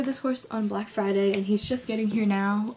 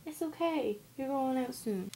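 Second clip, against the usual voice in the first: higher, softer cooing; soothing pet voice; 5 semitones higher, 5 dB quieter than usual. Real, not acted. Reassuring her cat it will go out soon, cooing. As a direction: coo to the cat gently.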